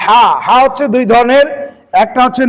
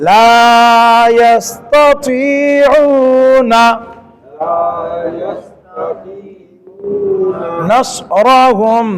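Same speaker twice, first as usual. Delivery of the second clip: tilawat recitation; 2 semitones higher, much slower than usual. Shown as a demonstration. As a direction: chant the verse slowly, lifting the last word. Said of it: "দুই তিনটা করে চেষ্টা করি". (ধীর সুরে কুরআন তিলাওয়াত)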